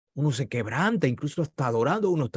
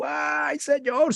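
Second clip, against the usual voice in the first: high-pitched retold wail to the Lord; wailing cry; 12 semitones higher, much slower than usual. Ezequías, told he will die, wails to God, high and crying. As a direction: cry out to God like the weeping king. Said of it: "seguro que gritó ahí en el palacio".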